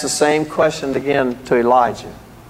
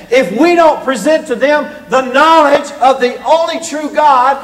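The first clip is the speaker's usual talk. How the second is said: high, raised preacher's voice; forceful preaching; 8 semitones higher than usual. Acted. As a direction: drive each phrase home from the pulpit.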